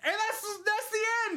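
very high, loud exclamation; worked-up outburst. Real, not acted. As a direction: cry out in disbelief at a cliffhanger. Outraged at cliffhanger ending.

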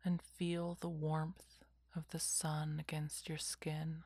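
whispered guided meditation; slow calming whisper, lots of pauses; soft spa whisper. Acted.

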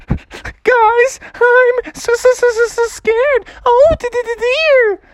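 high stuttering cartoon panic; hammed-up trembling fright, very high pitched; terrified inner voice. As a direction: stammer it in a squeaky, scared voice. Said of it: "Froggy was so nervous".

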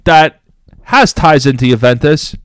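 ordinary delivery

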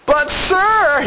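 high whining plea; whiny voice. Pretend. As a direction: start to whine. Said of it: "you gotta put that whiny voice on".

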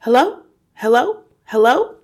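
high, loud hellos, each rising; mysterious calling voice. A narrator's reading. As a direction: call questioningly from another room. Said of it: "came the voice again".

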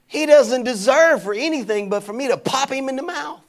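mock outrage; retold anger. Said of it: "I was so mad at this guy".

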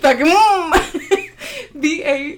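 laughing through the word. Real, not acted.

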